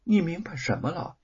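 puzzled. Acted. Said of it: "玉凤困惑不解地说".

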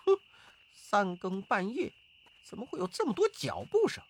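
high, suspicious musing; high-pitched, wary monkey character; puzzled monkey voice. Acted. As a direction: wonder aloud in a high monkey voice. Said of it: "疑惑道".

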